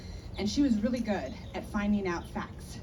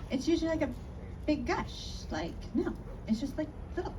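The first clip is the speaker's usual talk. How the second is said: higher, softer dismissive voice; Jamie denying; 7 semitones higher, 6 dB quieter than usual. Retold or imitated. Jamie insists to her friend it's nothing, dismissive. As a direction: brush it off lightly.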